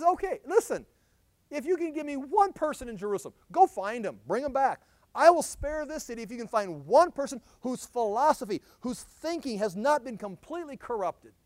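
lively God voice; eager voiced God. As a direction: plead the offer to the prophet.

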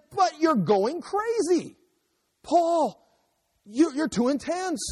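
very high, drawn-out mock plea; exasperated exclaiming. Governor telling Paul he's too intense, mock exasperated exclaiming.

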